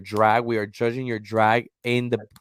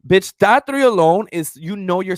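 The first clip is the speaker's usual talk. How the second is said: excited; 9 semitones higher, 9 dB louder than usual. Real, not acted. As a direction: burst out high and loud with excitement. Hyped about dream cast.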